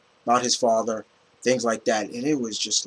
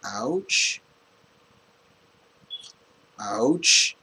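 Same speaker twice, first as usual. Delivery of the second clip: soft rising alien ouch, lightly voiced; a hint of E.T. saying ouch; 2 semitones lower, 4 dB quieter than usual. Movie fan imitating E.T. in pain, lightly voiced. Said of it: "I'm doing E T".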